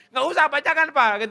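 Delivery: put-on high, loud kid's voice; child protesting to father. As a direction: wave Dad off in a high voice. Acted child refusing father's offer. (suara anak menolak, tinggi dan keras)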